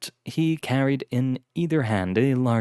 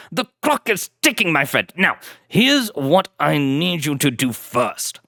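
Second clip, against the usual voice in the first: higher brisk English lord; urgent aristocrat; 4 semitones higher, 3 dB louder than usual. Acted. Blackwood giving his butler orders, urgent aristocrat. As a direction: press the point with urgency. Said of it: "impatience in Lord Blackwood's voice".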